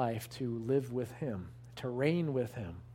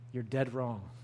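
dead leaned on, quiet weight; stress on dead; 5 semitones lower, 4 dB quieter than usual. Shown as a demonstration. Preacher warning the congregation, stress on dead. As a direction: press down on the word dead. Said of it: "Emphasis on dead".

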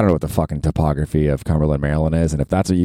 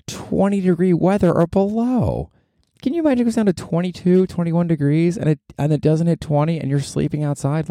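mock whine; 10 semitones higher than usual. Teens dreading sleeping outside.

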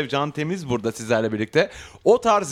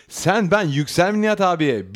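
mock Anatolian dialect, slow and a bit higher; regional accent; 6 semitones higher than usual. Host voicing the letter writer in a lightly mocked dialect. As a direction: drawl it in broken regional speech. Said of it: "şive yapmamak için".

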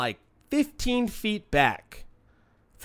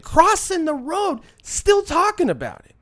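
exasperated; 10 semitones higher than usual. Retold or imitated. Exasperated at the hecklers.

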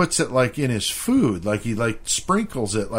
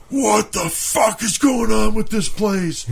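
high, slow, stretched-out yell; outraged mock scream; 8 semitones higher than usual. Friend causing a scene in the restaurant, mock scream. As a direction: imitate an over-the-top diner's outburst. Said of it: "screaming at the top of his fucking lungs".

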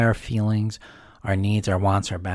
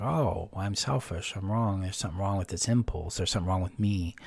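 much softer, self-blaming murmur; deflated self-blame; 3 semitones lower, 9 dB quieter than usual. Retold or imitated. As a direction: sink inward as if accepting fault. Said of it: "And they're like".

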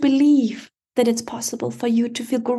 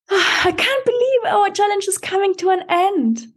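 teary; 7 semitones higher than usual. Real, not acted. Sad farewell at challenge end.

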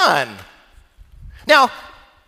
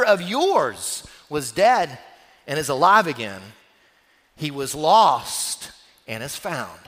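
tender father; 7 semitones lower than usual. Acted. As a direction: say it gently, like a loving father. Father's tender slow scripture quote.

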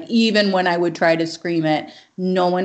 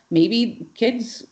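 usual speaking voice

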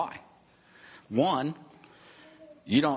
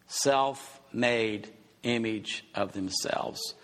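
Self-made image of themselves said slowly, each word weighed; slow emphatic repeat; 2 semitones lower, much slower than usual. Shown as a demonstration. Preacher to his congregation, slowly repeating the phrase. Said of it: "repeated for emphasis".

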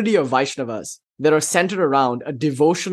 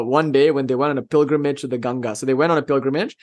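usual speaking voice

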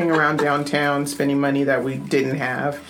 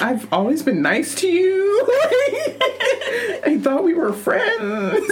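high, whiny mock-betrayed voice; mock hurt. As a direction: play the wounded friend. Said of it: "I was like".

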